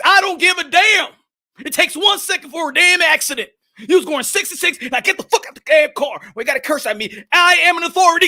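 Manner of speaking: mock angry cop orders him out. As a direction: rage at the driver. Cop yelling at driver.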